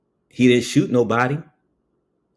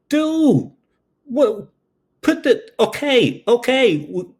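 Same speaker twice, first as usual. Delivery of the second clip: much higher, frantic pleading with hands up; panicked pleading; 12 semitones higher, slower than usual. Retold or imitated. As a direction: voice jumps high as you beg him to calm down.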